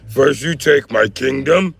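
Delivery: slow, high villain voice ending in a shout; Shao Kahn. Pretend. Mechanic as Shao Kahn taunting over his warhammer, loud. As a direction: taunt like a warlord. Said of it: "Shao Kahn accent".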